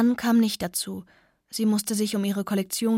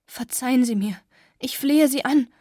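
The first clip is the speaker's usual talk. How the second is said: pleading; 4 semitones higher, 6 dB louder than usual. Acted. Begging Anne for forgiveness.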